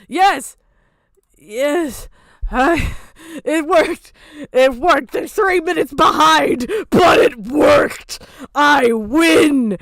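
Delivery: loud, high victory shouts; triumphant shouting. Real, not acted. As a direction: cheer like you just won. Stream finally works.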